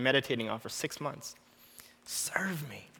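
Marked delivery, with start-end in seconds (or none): 2.0-2.9 a whispered serve me, no voice at all; barely breathed, the word "Serve" unvoiced and soft. Shown as a demonstration. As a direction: breathe "Serve me." as a hushed inner call. The phrase repeated in a hush.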